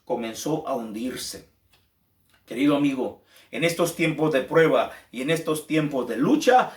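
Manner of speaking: ordinary delivery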